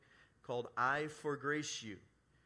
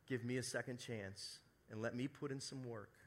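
usual speaking voice

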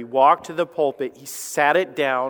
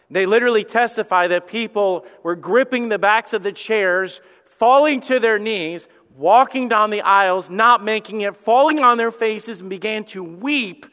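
rising preacher fervour; 5 semitones higher, 4 dB louder than usual. Real, not acted. Building revival account.

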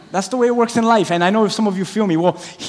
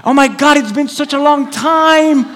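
pretend recognition; 7 semitones higher, slower than usual. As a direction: fake delighted greeting, higher and drawn out. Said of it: "you pretend".

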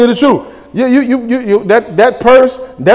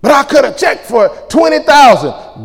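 mock proud shout; 8 semitones higher than usual. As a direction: shout it like a bragging church member. Preacher voicing a proud giver.

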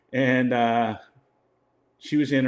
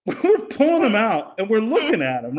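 amused; 7 semitones higher, 4 dB louder than usual. Real, not acted. Recalling the signed photos, amused.

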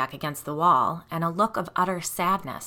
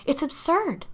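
higher, indignant protest; indignant insistence; 6 semitones higher than usual. A narrator's reading. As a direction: reject the accusation as absurd. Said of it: "she insisted".

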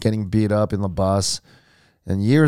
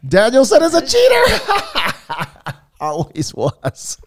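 laughing; 12 semitones higher, 4 dB louder, much slower than usual. Real, not acted. Laughing reply to the friend's joke.

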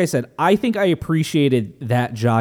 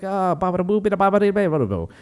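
mock Italian blessing; 6 semitones higher, much faster than usual. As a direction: fake Italian gibberish, fading out. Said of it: "my Italian".

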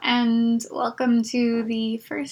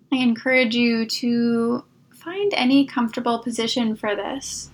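soft, calm meditation voice with long pauses; guided meditation; 3 dB quieter than usual. Acted. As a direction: ease the listener toward sleep, unhurried. Leads a Yoga Nidra meditation.

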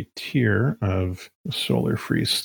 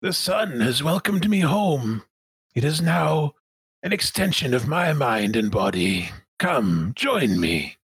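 Sun Minister De Winton; 5 semitones higher than usual. Acted. Villain inviting the crew to join it, grand and drawn-out. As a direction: proclaim it slowly like a self-made god. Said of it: "says Sun Minister De Winton".

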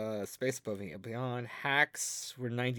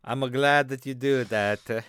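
louder, slower put-on voice; new character; 7 dB louder than usual. Co-host showing off his new character, louder and slow. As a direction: present a pompous new character. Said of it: "this is a new character I work on".

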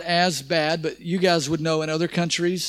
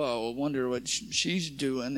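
quiet envious muttering, much softer; judgmental mutter; 7 semitones lower, 10 dB quieter than usual. Retold or imitated. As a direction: mutter it with envy. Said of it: "judgmental eyes and go".

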